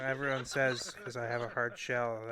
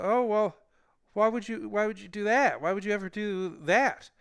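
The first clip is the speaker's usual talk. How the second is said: nervous protest; 6 semitones higher, 4 dB louder than usual. Retold or imitated. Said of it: "he made me very nervous".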